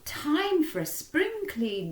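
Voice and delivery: put-on high, lively old lady announcing chores; chirpy old lady calling the household to work. Old lady rallying her pets to clean, high and bright.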